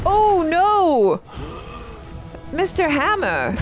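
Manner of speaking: ordinary talk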